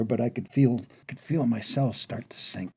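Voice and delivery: plain reading voice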